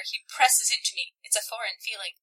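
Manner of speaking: ordinary talk, nothing marked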